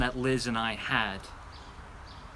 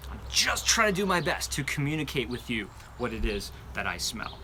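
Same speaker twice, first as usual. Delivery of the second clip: ordinary delivery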